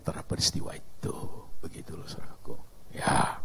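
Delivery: soft whispered aside. Real, not acted. Preacher whispering to the congregation, hushed. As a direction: drop to a whisper for the brothers.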